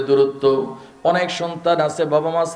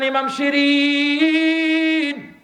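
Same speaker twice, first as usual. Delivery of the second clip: higher, long drawn-out call; 10 semitones higher, much slower than usual. Acted. Imam Ghazali calling out to Imam Shirin, drawn out. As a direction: call the name long and slow across a distance. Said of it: "ডাক দিয়ে বলেন".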